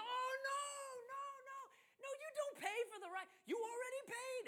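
high, loud reassuring correction; amused protest. Retold or imitated. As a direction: laughingly set them straight. Retold surprised reply to tourists.

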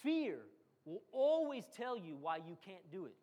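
ordinary talk, nothing marked

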